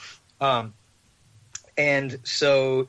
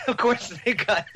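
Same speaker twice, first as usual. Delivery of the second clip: higher, words breaking into laughter; laughing; 10 semitones higher than usual. Real, not acted. Driver realizing it's the weed dispensary, laughing.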